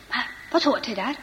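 usual speaking voice

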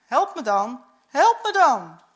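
calling out. A narrator's reading. Peter begs the narrator to help carry the Bible, calling out. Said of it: "En Peter riep".

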